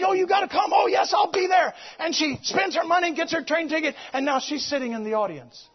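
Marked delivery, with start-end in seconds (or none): 0.0-1.7 high, loud, happy back-and-forth; eager invitation. Retold or imitated. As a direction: urge her to come and agree at once. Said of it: "She says".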